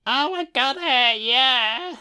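a hint of a high naive girl; falsetto Janet. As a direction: chirp it as the starry-eyed bride. Voicing Janet on screen.

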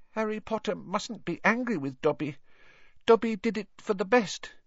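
high, small elf voice, apologetic; Dobby, placating. Acted. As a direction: soothe him nervously as a little elf. Elf placating angry boy.

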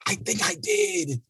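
mock guilty squeak. Shown as a demonstration. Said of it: "repeated admission, first take".

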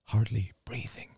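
plain reading voice

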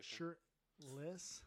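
Shirtless said slowly and clearly, each syllable distinct; slow clear word. Shown as a demonstration. Repeating Shirtless slowly for a friend who misheard. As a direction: spell out Shirtless carefully for the listener. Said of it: "What word is it?".

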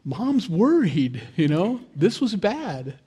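a hint of a worried kid, drawn out slowly; mildly mimicking a scared boy; child's voice. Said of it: "And he went".

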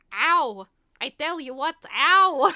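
loud, drawn-out comic ows; cartoon bat's mock pain after a hard landing; Bartok. Bat landing after being dragged to the underworld, mock-pained yelp. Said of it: "when he finally lands".

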